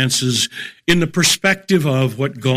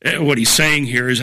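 normal talking voice

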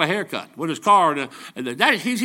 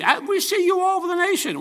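people's voice; 12 semitones higher than usual. Retold or imitated. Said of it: "some people say".

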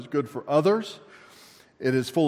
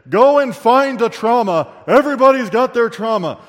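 mocking pushy advice-givers; 5 semitones higher, 9 dB louder than usual. Preacher mocking self-help advice, louder and insistent. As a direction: parody the people who say find your trauma, hammed up and louder. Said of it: "'cause some people tell you this".

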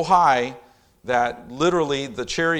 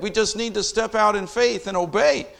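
ordinary delivery

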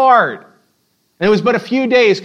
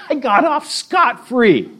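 smug; 5 semitones higher than usual. Retold or imitated. Smug sinner voiced.

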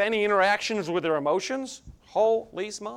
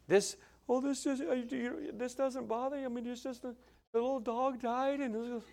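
soft, higher, mock weepy voice; imitating tearful wife; 5 semitones higher, 12 dB quieter than usual. Husband imitates his wife crying over the little dog, mock weepy. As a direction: mimic a wife choking up over a sad film. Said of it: "And she".

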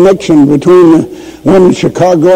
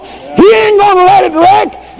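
high, ringing shout of faith; preacher proclaiming God won't let it wreck; preaching at full force; 12 semitones higher than usual. Shown as a demonstration. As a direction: cry it out to the church. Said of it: "My Father's running this thing".

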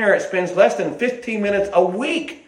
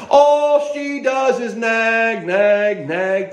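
put-on exasperated teen complaint; 3 semitones higher, much slower than usual. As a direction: slowly wail it like a fed-up teenager. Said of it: "my biggest problem is my mother".

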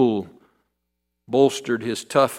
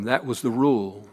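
ordinary talk, nothing marked

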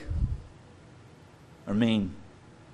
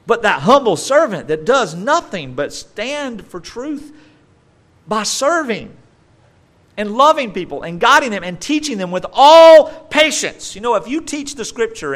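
impassioned preaching; 10 semitones higher, 6 dB louder than usual. Acted. Preacher rising to the climax of his sermon. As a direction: rise and swell to the final point.

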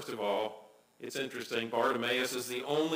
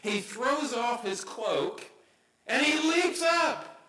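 dramatic build; 7 semitones higher, slower than usual. A narrator's reading. Dramatic retelling of leap.

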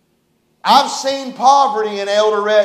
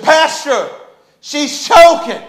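imitating an urgent alert, higher; retold alarm; 5 semitones higher, 5 dB louder than usual. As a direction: raise the voice like a worried bystander. Woman alerting pastor to choking child.

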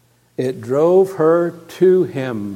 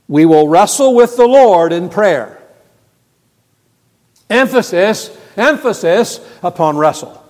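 raised, loud pulpit exhortation; forceful preaching; 5 semitones higher, 7 dB louder than usual. Real, not acted. Preacher urging people to wrestle in prayer, forceful. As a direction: drive the point home to the congregation.

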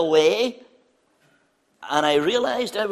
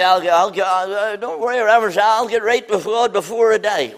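mock careless sinner; 3 semitones higher, 6 dB louder than usual. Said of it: "oh, you say".

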